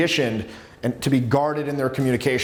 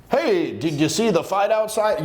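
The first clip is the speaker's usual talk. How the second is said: drawn-out, higher casual approach line; over-the-shoulder opener; 5 semitones higher than usual. Acted. Coach imitates an old pickup opener, over-the-shoulder delivery. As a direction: toss it out slowly, as if in passing. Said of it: "the open over the shoulder stuff".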